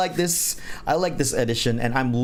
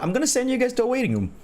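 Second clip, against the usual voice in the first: excited; 9 semitones higher, much faster than usual. Real, not acted. Sudden excited decision.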